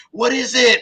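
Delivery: high, loud mock-curious question; retold burst of curiosity; curious onlooker. Onlookers' question about the vehicle, retold.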